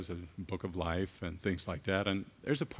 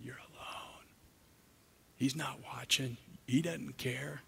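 eerie whispered taunt, higher and far-off; Satan whispering; 5 semitones higher, 5 dB quieter, slower than usual. Acted. Preacher voicing Satan whispering to the suffering, sly whisper. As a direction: hiss the lies like a tempter in the ear. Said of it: "Satan's sitting there whispering".

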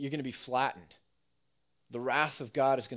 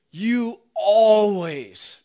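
slow, loud, higher accusing shout; mock angry yell; 6 semitones higher, 13 dB louder, much slower than usual. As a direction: hurl the accusation in a mock argument. Acted quarrel, angry partner.

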